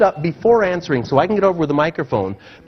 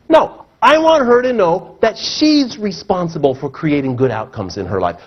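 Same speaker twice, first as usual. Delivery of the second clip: ordinary delivery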